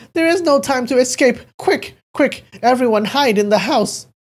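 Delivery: very high, loud panicked warning; frightened urging. A narrator's reading. Elderly man telling everyone to hide, panicked. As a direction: urge them to hide, voice shooting up. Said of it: "his expression extremely frightened".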